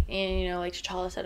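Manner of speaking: ordinary delivery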